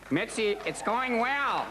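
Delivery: high, very slow sweet-talking; pleading comic. Retold or imitated. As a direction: reassure her, slowly and high. Talking to club owner.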